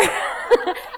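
real high laugh. Real, not acted. Laughing at her own joke.

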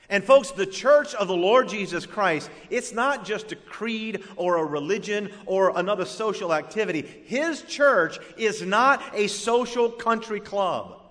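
emphatic preaching voice. Real, not acted. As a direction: preach it with force. Preaching at full force.

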